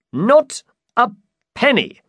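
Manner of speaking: high, emphatic knight; high-pitched storybook knight; Don Quixote. Acted. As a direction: answer proudly in a high voice. Don Quixote answers innkeeper.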